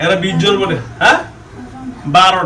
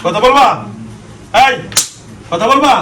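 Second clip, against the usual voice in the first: shouting; 5 semitones higher than usual. Real, not acted. Exorcist demanding the jinn speak.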